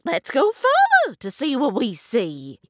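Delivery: lively hare voice; eager hare. A narrator's reading. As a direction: urge them on as Hare. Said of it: "Hare says".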